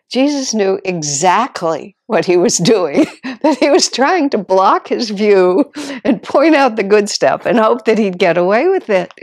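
loud laughing delivery, voice breaking up with mirth; laughing while telling. Real, not acted. To the congregation, laughing at the Eagle Scout trick. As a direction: tell it through rising laughter.

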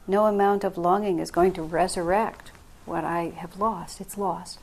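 ordinary delivery

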